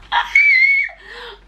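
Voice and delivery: a loud, high laughing burst at herself; loud burst of laughter. Real, not acted. Telling viewers about fan sign nerves, loud high laugh. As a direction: crack up at your own nerves.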